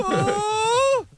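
very high, drawn-out wail; mock a mother answering in a long falsetto howl; Mexican mom on phone. Impersonating a Mexican mom answering the phone, high wailing caricature. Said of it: "my impression of a Mexican mom answering the phone".

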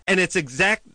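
high-pitched worked-up rant; excited rant. Real, not acted. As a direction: get worked up and fire off a complaint.

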